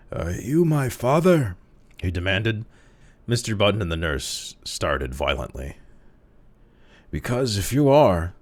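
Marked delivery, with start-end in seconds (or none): 0.1-1.6 put-on high, slow, cracked old man's voice; cracked ancient voice. A narrator's reading. Old man in the crib asks the father, cracked ancient voice. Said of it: "spoke in a cracked and ancient voice". 7.1-8.3 put-on louder, higher querulous old voice; querulous old man. A narrator's reading. Old man complains to his father, querulous. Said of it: "went the old man querulously".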